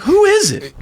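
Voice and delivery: high-pitched accusing question; retold demand. Retelling how he called his friend out, demanding. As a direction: press the friend for a culprit, voice shooting up. Retold confrontation over sold packages.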